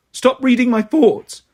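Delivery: indignant protest. Acted. Girl telling off Vicky for reading her mind, indignant. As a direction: protest with rising exasperation. Quarrel with intrusive ghost.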